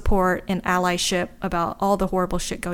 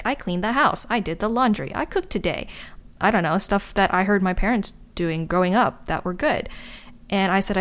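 ordinary talk, nothing marked

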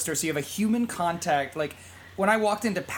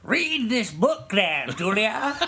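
slow, drawn-out hobo voice; a lightly voiced old drifter; rambling folksy hobo; 4 semitones higher, 3 dB louder, much slower than usual. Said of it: "I don't know if that was the right Hobo Johnny voice".

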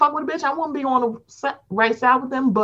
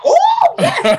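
very high, louder squeal of "Oh, yes"; high mock delight; 6 dB louder than usual. Host imitates Juan's giddy reaction for her cohost, very high squeal. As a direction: squeal it gleefully. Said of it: "And he's like".

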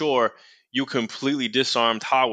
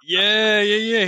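excited agreement; 10 semitones higher, 5 dB louder, slower than usual. Real, not acted.

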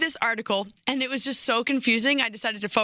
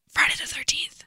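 spooky whisper; 5 semitones higher than usual. Pretend.

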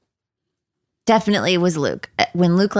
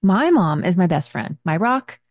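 upbeat podcast ad read; 2 semitones lower than usual. Acted. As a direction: sell it like a sponsor spot. Reads a sponsor ad.

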